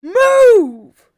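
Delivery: drawn-out, high bellow; full-force repeated shout. A narrator's reading. Soldier to Joel who will not budge, high bellow. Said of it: "he repeats himself".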